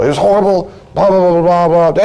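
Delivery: high, mock-upset rattle; hammed-up complaining; acted venting. Acted spouse venting about work.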